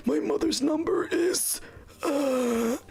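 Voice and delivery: high, breathless whisper, fading out; mock dying gasp. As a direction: play a bleeding victim barely getting words out. Imagined dying victim gasping.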